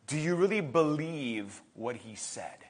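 very soft, low, searching; hushed question. Real, not acted. Preacher asks congregation whether they believe, hushed. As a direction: ask it quietly and earnestly.

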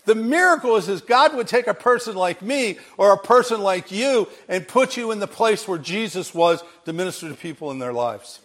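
usual speaking voice

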